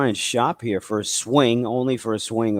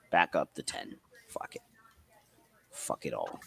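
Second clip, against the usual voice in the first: whispered mutter; 9 semitones lower, 10 dB quieter than usual. Real, not acted. Muttering to himself.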